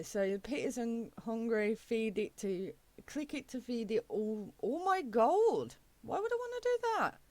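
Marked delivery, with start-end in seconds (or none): 6.0-7.2 squeaky mock voice. Mock reply to game asking gold.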